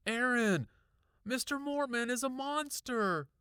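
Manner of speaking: high, loud cry of alarm; breathless cry. A narrator's reading. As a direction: urgently shout a warning, high-pitched. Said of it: "I cried breathlessly".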